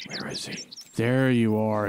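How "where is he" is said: breathless whisper while stalking; hushed, voiceless question; hunting whisper. Real, not acted. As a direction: whisper so the deer won't hear. Hiding while hunting a deer.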